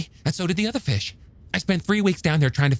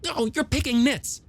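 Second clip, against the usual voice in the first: a touch of testy impatience, higher and slow; testily; 6 semitones higher than usual. Acted. Hooper brushing off Brody's point, mildly testy. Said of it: "Hooper said testily".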